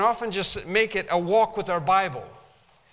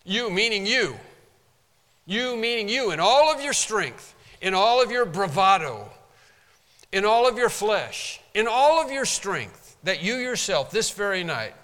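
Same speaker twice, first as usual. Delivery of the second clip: louder and higher, each line hammered harder; preaching at full force; 3 semitones higher, 5 dB louder than usual. Acted. Preacher driving home Peter's bravado, loud preaching. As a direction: build the preaching to a pitch.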